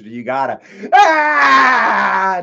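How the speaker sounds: dramatic yell. Shown as a demonstration. Streamer shows chat his dramatic thumbnail yell. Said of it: "be like me and give it to".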